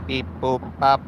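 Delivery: lightly voiced robot beeps. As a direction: beep it out like a robot. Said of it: "Robot voice".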